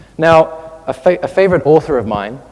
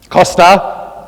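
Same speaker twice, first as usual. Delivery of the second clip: louder, higher imitation of a friend calling his name; friend's address; 4 semitones higher, 6 dB louder than usual. Friend addressing him by name, imitated emphatic call. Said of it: "that's how my friend speaks to me".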